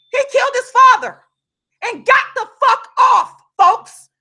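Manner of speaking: high, loud outraged yell; furious yell at the injustice; real yelling. Real, not acted. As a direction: shout it out in disbelief. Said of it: "I'm sorry I'm yelling".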